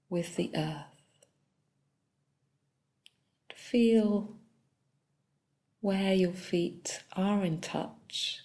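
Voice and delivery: soft, unhurried body-scan guidance with long pauses; calm meditation leader, phrase by phrase; guided meditation. Real, not acted. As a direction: guide them gently through the body, leaving long silences.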